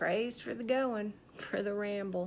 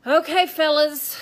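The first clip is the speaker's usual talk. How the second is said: loud, raised call to the fellas; 7 semitones higher, 12 dB louder than usual. Acted. Speaker summons the reporters to start, calling out. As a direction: call the room to order, high and loud.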